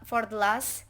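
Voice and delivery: ordinary talk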